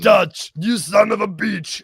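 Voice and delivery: loud, slow manly bellow; hammed-up macho shout; macho movie greeting. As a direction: boom it like a buddy action hero. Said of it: "acted Predator movie quote".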